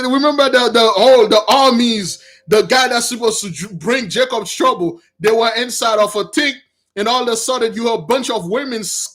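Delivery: usual speaking voice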